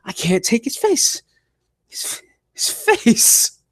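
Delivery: high, slow, breathy groan of disgust; strained disgust. Real, not acted. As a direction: squeeze it out like you can't bear to look. Disgusted at Miz's face.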